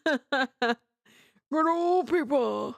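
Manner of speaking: lightly voiced grandpa; Peepaw voice. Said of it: "we referenced Peepaw".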